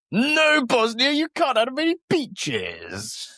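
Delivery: high, slow mock Croatian voice; mock Croatian accent. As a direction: gloat in a hammed-up Croatian accent. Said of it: "That's a very good accent".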